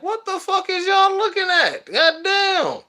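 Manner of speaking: high-pitched mock indignant yell; defensive outburst. Staring schoolmates get a high indignant retort. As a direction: snap back at the staring crowd. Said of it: "I'm like".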